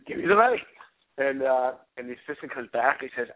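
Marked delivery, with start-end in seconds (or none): 0.1-0.6 mumbled [name]. Retold or imitated. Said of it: "something like, 'Give me some height'".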